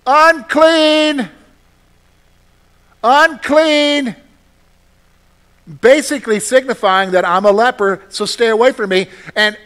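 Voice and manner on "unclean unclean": high, loud warning cry; yelling out. Retold or imitated. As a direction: call out to warn people off. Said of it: "I would have to yell out".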